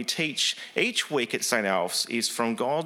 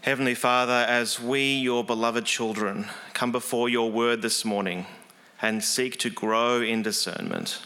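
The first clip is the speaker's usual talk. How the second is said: calm, measured prayer voice, a little lower; opening prayer; 4 semitones lower than usual. Real, not acted. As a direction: pray on behalf of the congregation, unhurried. Leads congregation in opening prayer.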